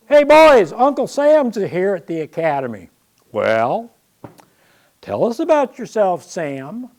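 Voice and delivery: loud, high rowdy ribbing; rally the boys to tease him; teasing call. Retold or imitated. Cadet Sherman calls the others over, loud teasing.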